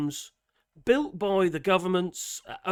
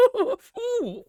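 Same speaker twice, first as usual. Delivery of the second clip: excited squeal; 14 semitones higher than usual. Pretend. Vlogger pretending the bell icon tickled him, squealing. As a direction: squeal like you've been tickled. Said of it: "I get too excited I am".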